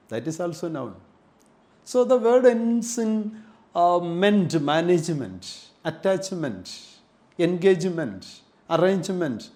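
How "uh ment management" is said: usual speaking voice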